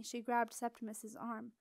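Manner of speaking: ordinary delivery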